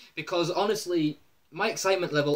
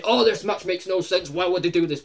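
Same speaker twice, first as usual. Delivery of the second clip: a bit higher and louder, mocking the complaints; mocking whiny fans; 3 semitones higher, 6 dB louder than usual. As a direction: mimic the complaining fans scornfully.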